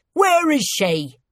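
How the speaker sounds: high, slow, forceful question; menacing demand. A narrator's reading. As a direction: demand the answer as a last warning. Rebel's final threatening demand.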